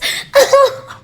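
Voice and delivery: high, giddy laugh; overwhelmed laugh. Real, not acted. Fan overcome at meeting her idol, giddy laughing.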